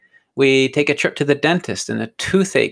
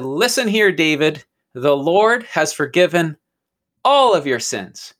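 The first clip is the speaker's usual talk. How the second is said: emphatic, higher-pitched address to himself; David's self-pep-talk; 3 semitones higher than usual. Acted. David addressing himself in the mirror, firmly exhorting. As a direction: declare it firmly, in short punched phrases. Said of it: "and he said".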